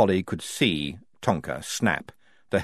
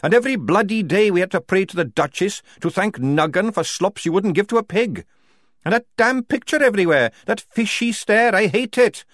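loud bitter outburst, pitched higher; a soldier raging against the Duchess; furious rant; 11 semitones higher, 5 dB louder than usual. A narrator's reading. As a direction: let the anger spill over.

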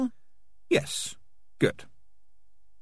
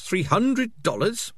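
Three hundred dollars? leaps high on hundred, slow and incredulous; shocked question; 12 semitones higher, slower than usual. A narrator's reading. Morris outraged at the fee, rising into a high shocked question. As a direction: squeak out "hundred" in disbelief. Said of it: "repeated, then asked".

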